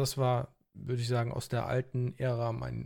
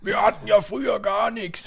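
put-on grumpy old man; 7 semitones higher, 7 dB louder than usual. Mock old-timer complaint.

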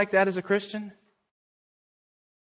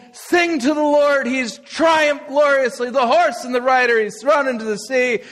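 jubilant proclamation; 9 semitones higher, 4 dB louder than usual. Retold or imitated. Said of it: "Miriam sang".

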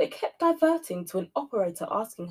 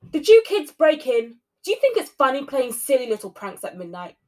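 exclaiming; 3 semitones higher, 8 dB louder than usual. A narrator's reading. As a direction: tell off the pranksters. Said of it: "she exclaimed".

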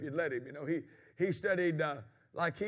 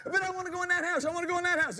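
higher pitched pleading little imp; little devil begging the boss, higher and whiny; whining little devil; 10 semitones higher, 4 dB louder, faster than usual. Retold or imitated. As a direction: whine to be let in the house. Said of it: "He said".

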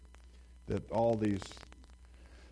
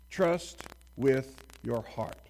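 "Trust with your heart" slowly, each word weighted; slow deliberate verse reading; slow emphatic reading; 2 semitones higher, 3 dB louder, much slower than usual. Shown as a demonstration. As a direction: draw out every word. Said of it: "emphasis on every single word".

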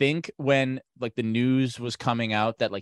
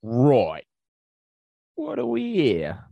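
put-on terrible British accent; 2 semitones higher, 3 dB louder, slower than usual. Bobby arriving with his partner, terrible British accent. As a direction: play a badly faked British copper. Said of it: "do my terrible British accent".

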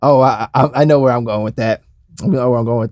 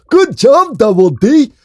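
high, strained cartoon voice; excitable schemer rallying a pal; cartoon schemer impression; 9 semitones higher, 4 dB louder than usual. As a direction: call it out high in a hammed-up cartoon voice. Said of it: "I'm too hoarse".

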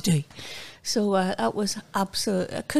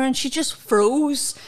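ordinary talk, nothing marked

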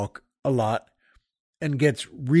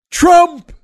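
very high, booming imitated holler; one-word scream; 13 semitones higher, 10 dB louder than usual. Retelling a man shouting across a parking lot, screamed. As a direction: belt out one word like the stranger. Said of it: "he just screamed".

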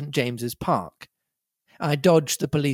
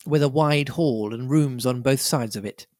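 ordinary delivery, nothing marked

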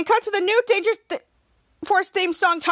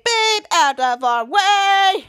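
louder call with very long last word; 3 semitones higher, 5 dB louder, much slower than usual. Retold or imitated. Helpers clearing a path for hero, drawn-out call. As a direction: honk it, drawing the ending right out.

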